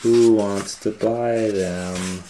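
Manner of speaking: loud, slow street call; calling out wares. A narrator's reading. Peddler calls to buyers in town, slow loud street call. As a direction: call out to buyers, stretching the words. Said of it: "he went back to town calling".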